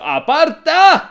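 very loud, high, drawn-out yell; defender's shout. Acted. Defender orders the striker out of his way, drawn-out shout. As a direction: bellow it, stretching the word out. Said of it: "le ha dicho al delantero ... te grita".

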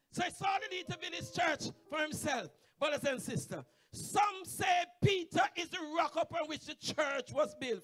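forceful, high sermon delivery; full-force preaching. Real, not acted. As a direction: press the argument home at a shout.

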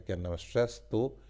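usual speaking voice

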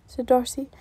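ordinary delivery, nothing marked

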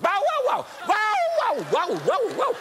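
high, loud yapping barks; barking like a dog. Retold or imitated. Possessed woman barking at the preacher, loud yaps. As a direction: imitate a dog barking wildly. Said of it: "She began to bark like a dog".

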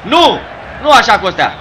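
high, loud cry of protest; exasperated shout. Real, not acted. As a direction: shout in dismay at the player's mistake. Scolding player for a mistake.

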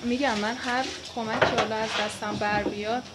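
usual speaking voice

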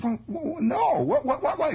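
put-on high, breathless kid begging to hear; excited kid voice; eager kid. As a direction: pester for the juicy news like a little boy.

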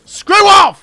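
loud, high shout; angry dismissal. Real, not acted. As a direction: yell the enemy away. Yelling at hopping enemy.

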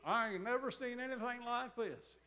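exaggerated Oklahoma accent, high and loud. Bald prison guard astonished, loud mock Oklahoma accent. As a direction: belt it out like an amazed Oklahoma guard. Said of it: "the Oklahoma accent".

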